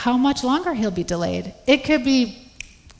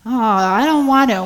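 whiny refusal; 3 semitones higher, 7 dB louder than usual. Retold or imitated. Mother imitating sons whining about cleaning the kitchen. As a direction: drag it out like a reluctant child. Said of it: "kids refusing to clean kitchen".

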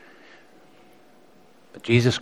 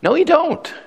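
high, forceful "No, you don't" with the pitch leaping on "No"; sharp denial; 13 semitones higher than usual. Shown as a demonstration. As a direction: fling it back much higher, flatly refusing. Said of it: "I know that's a paraphrase".

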